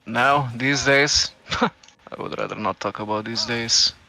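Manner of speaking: usual speaking voice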